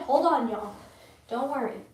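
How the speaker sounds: ordinary talk, nothing marked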